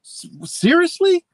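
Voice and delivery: high, drawn-out incredulous question. Retold or imitated. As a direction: stretch it out in high-pitched disbelief. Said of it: "I said it just like that".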